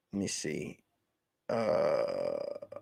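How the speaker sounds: ordinary delivery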